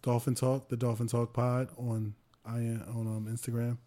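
soft, low, trailing podcast plug; low-energy plug. Real, not acted. Host plugging podcast handles to listeners, low-energy. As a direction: read the handles with no energy, dropping away. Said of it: "you sound so energetic, bro. Can you calm down".

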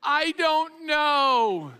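put-on tearful kid. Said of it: "they answer like this".

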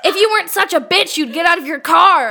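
hammed-up furious yelling, laughing through it; imitating an enraged shout. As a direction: shriek it like the crazed lady leaning out her window. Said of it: "And then she goes".

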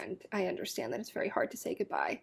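soft comforting. Real, not acted. Comforting friend about dying cat.

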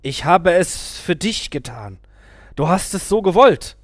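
plead, rising into a shout; a guru justifying himself to the gunman. A narrator's reading.

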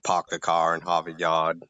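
New England accent with the R dropped in "park", "car" and "Yard"; light demo of a Boston accent; Boston accent, R-dropping. Shown as a demonstration. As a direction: say it like a Bostonian, "pahk the cah". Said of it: "dropping the R".

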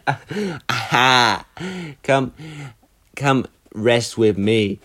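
slow drowsy seductive voice; sleepy fuckboy. Retold or imitated. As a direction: lure them to bed, slowly. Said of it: "fuckboy insomnia".

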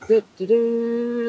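slow, high singsong doo-doo; feigned innocence. Retold or imitated. Girl hiding her secret during girl talk, innocent singsong. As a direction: idly hum as if clueless. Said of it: "I'm sitting there going".

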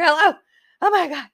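loud, high, rushed hello; nervous owner blurting into the phone; frantic hello. Retold or imitated. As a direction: grab the phone in a panic.

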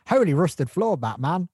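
loud, high sidekick exclamation; exclaiming Robin. Retold or imitated. Quoting Robin's joke to Batman, mock exclamation. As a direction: call it out like an excited comic-book sidekick. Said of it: "is when he went".

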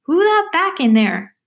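a youngster's high, loud challenge. Acted. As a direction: call out high and drawn-out.

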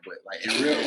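ordinary talk, nothing marked

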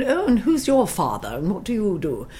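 put-on posh English accent asking about your father, teasing; mock posh accent. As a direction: play a snooty grammar-school girl in a posh English accent. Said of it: "talking like this".

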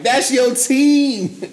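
high, loud teasing through laughter; laughing tease. Retold or imitated. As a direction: tease her while cracking up.

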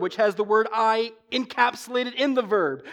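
normal talking voice

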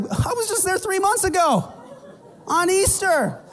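very high, innocent voice; mimicking the visitor. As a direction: sound proud of a rare visit. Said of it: "they're like".

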